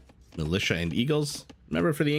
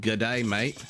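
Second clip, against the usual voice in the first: put-on atrocious Australian accent; slower than usual. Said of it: "in my atrocious Australian accent".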